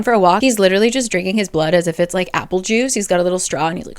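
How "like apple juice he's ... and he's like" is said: usual speaking voice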